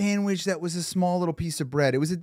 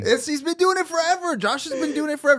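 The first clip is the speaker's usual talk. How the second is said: high-pitched hype; 11 semitones higher than usual. Pretend. Hyping up Josh mockingly.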